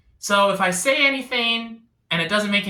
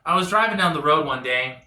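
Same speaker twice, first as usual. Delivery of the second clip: ordinary delivery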